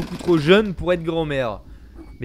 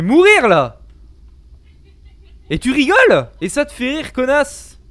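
loud shaken anger; angry frightened yelling; panicking gamer; 7 semitones higher, 9 dB louder than usual. Real, not acted. After scare, angry at ghost.

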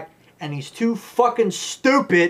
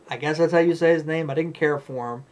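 usual speaking voice

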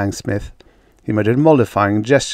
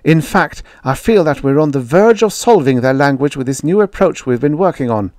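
high, louder indignant retort; raised pitch, heated and insistent; sudden anger; 6 semitones higher, 6 dB louder than usual. A narrator's reading. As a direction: flare up at a dismissive colleague. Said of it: "She spoke in sudden anger".

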